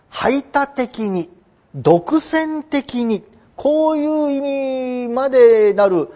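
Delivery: 排他的に and 独占的に drawn out slowly, word by word; slow emphasis. Shown as a demonstration. Teacher stressing 排他的に and 独占的に for students, slowly. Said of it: "本当に何々だけ".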